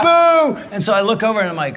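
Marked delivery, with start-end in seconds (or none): 0.0-0.6 much higher shouted boo; playful loud shout of boo. Retold or imitated. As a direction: heckle the wife on stage. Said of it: "and I go".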